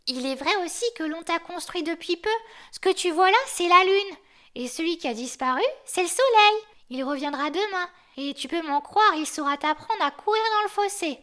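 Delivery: high, put-on voice for the old dog; the hoarse yard dog lecturing the newcomer; grumpy old guard dog. The chained dog explains sun and moon to the snowman, in a high put-on voice.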